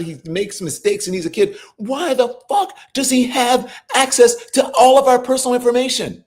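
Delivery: ordinary delivery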